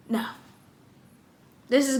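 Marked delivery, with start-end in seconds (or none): none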